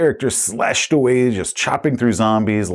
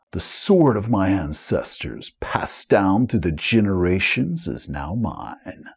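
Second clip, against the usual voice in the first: slow, lowered, solemn hero voice; grandiose hero; 2 semitones lower, slower than usual. Acted. Player voicing his gamer cameraman, slow solemn hero. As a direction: declaim it like a destined champion.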